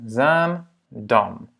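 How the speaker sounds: Zaandam in two separate syllables, Zaan long, dam short; long, then short. Shown as a demonstration. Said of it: "long, court, c'est assez facile". (« Zaandam » en deux syllabes, longue puis courte)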